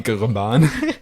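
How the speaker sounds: "Trevan" mangled with a comic extra gurr; hammed-up Aussie tray-guh-BAHN for "Trevan"; mock Aussie mispronunciation. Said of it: "like the Aussies we are".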